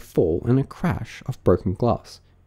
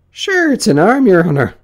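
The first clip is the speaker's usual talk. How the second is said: high, loud rustic servant; servant Pat; 10 semitones higher, 8 dB louder than usual. Acted. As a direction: answer the master loudly, pitched up. Pat answers his master.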